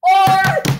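a quick real shout to flag a player; calling out. Real, not acted. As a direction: call the name out loud. Calling out the suspect.

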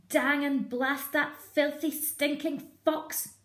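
high, loud cursing farmer; Boggis swearing. Acted. Boggis cursing the fox, high and loud. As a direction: spit it out high and furious. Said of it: "he said".

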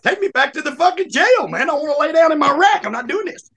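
high-pitched retold indignation; defiant inmate. Inmate telling the guard he quits, defiant. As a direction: argue back, voice pushed up, as in a lively retelling.

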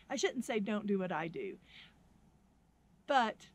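usual speaking voice

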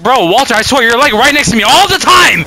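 annoyed rant. Real, not acted. Scolding teammate Walter.

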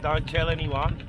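ordinary talk, nothing marked

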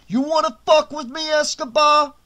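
Tony throttling the waiter against the wall; growled. A narrator's reading. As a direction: choke the words out at him. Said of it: "Tony growled".

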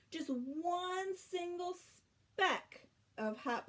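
drawn-out, high and slow; slow emphasis. A narrator's reading. Reading to children, drawing out each word slowly. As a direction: stretch each word for effect. Read-aloud emphasis, slowed.

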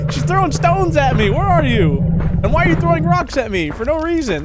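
loud, high, outraged yell; indignant shouting. Real, not acted. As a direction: shout it out at the attacker. Shouting at rock thrower.

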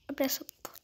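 soft, breathy whisper of the command; whispered urging. Real, not acted. Gamer whispers at the game to open the door. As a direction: hush it to the screen. Same demand now whispered.

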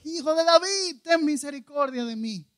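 high, loud pleading cry; shouting even harder. Acted. Blind Bartimeo refusing to be silenced, shouting for mercy. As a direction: belt it out over people hushing you. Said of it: "él gritando más fuerte todavía".

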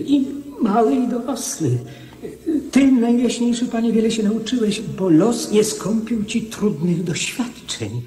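loud, high and fast, with a laugh; excited encouragement. Acted. Minister cheering up the young king, loud and laughing. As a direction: lift the king's spirits, bursting with cheer.

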